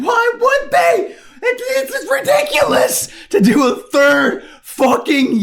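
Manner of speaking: high laughing cry of disbelief; incredulous laugh. Retold or imitated. Everybody reacting to a third event, laughing disbelief. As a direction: laugh out the absurdity. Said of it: "everybody's thinking".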